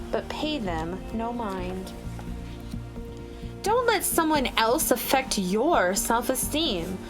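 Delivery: ordinary delivery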